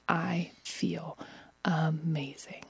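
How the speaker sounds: soft, slow whispered bliss; hushed elation. Retold or imitated. She whispers to viewers how amazing she felt. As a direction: whisper it with a glow.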